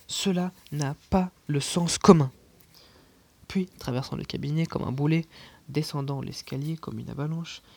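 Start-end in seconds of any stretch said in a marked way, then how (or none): 0.1-2.3 very loud angry outburst; furious shout. A narrator's reading. Uncle rages at the nonsense letters, shouting. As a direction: bellow it in disgust. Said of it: "s'écria mon oncle".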